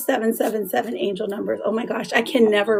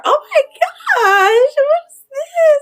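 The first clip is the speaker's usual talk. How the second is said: much higher, louder, slow delighted squeal; 14 semitones higher, 5 dB louder, slower than usual. Real, not acted. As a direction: squeal over a cute surprise. Delighted by a gift from a viewer.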